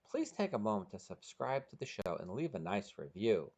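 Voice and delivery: ordinary delivery, nothing marked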